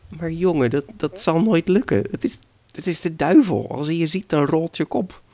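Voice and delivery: high-pitched, urgent grandmother; alarmed warning. Acted. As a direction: warn the boy off his mad plan. Grandmother warns boy about devil. (bezorgd waarschuwend, hoge stem)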